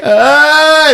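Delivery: high, loud comic shriek; mock frightened scream. Candy striper running away from a threat, mock scream. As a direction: scream like a girl fleeing in panic, played for laughs.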